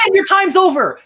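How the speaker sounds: loud, high yell at a co-host. Real, not acted. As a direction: cut him off with a shout. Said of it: "I didn't mean to yell at you".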